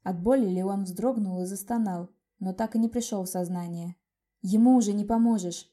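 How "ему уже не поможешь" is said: usual speaking voice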